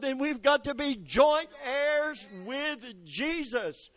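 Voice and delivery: high, loud, driving preacher; rising sermon fervor; preaching at full force. Acted. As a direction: press it home to the congregation. Preaching builds again.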